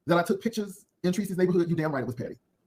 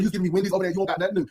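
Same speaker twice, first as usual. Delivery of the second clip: ordinary talk, nothing marked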